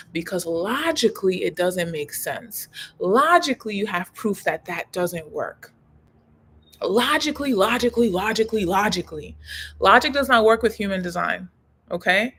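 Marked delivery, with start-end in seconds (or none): 3.0-3.7 Logically punched higher and louder; emphatic logically. Acted. Stressed repeat of logically. 6.8-9.4 descending chant of logically; fading repeat. Acted.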